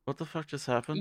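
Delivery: soft, stunned aside; stunned murmur. Retold or imitated. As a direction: mumble it in disbelief. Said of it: "is just like".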